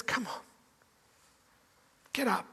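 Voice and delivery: very soft, hushed reassurance; tender whisper. Acted. Jesus gently raises his terrified disciples, in a tender whisper. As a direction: whisper it gently, as if lifting someone up. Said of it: "And Jesus says".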